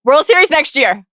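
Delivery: high loud cheer; excited cheer. Real, not acted. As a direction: cheer him on. Cheering on a ballplayer.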